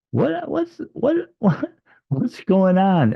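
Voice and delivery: retold bewildered yelp; baffled squeak. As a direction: break into a high, laughing disbelief. Retold confused outburst.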